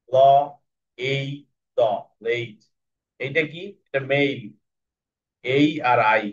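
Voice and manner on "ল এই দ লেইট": ল, এই, দ, then blend into লেইট; sounding out letters. Shown as a demonstration. Teacher sounds out letters to build লেইট. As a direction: sound out each letter, then join them. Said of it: "হেন কি করতেছে".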